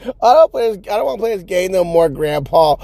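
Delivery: put-on high, quick, pleading child; mock scared kid. Scared kids begging Grandpa to stop, mock pleading. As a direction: plead to quit in a hurried kid voice. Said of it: "like, more scared".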